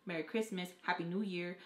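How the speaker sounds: usual speaking voice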